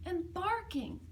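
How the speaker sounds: ordinary delivery, nothing marked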